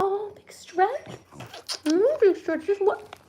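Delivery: high, quiet coo at a stretching pet; pet talk. Real, not acted. Talking to her stretching pet.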